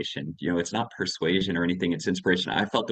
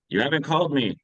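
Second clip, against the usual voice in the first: higher, reproachful parent's complaint; mock guilt trip; 7 semitones higher than usual. Father greeting grown child on the phone, mock guilt trip. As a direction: lay on the guilt, imitating a parent.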